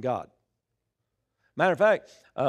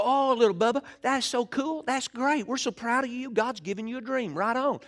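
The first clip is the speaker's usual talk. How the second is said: higher, gushing pretend praise; imitating adoring brothers in a raised voice; mock-proud brothers; 7 semitones higher than usual. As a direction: coo like doting big brothers, put on. Brothers' imagined gushing praise of Joseph.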